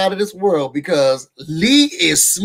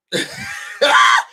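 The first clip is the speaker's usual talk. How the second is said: high, shrieking burst of laughter. Real, not acted. Cracking up at her own Lee joke, shrieking laugh. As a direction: let out a squealing laugh.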